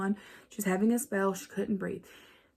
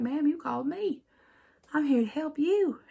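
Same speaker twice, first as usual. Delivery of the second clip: lightly voiced paramedic calming an old lady; a hint of a polite responder, pitched up; patient paramedic; 7 semitones higher than usual. Paramedics answering insistent granny.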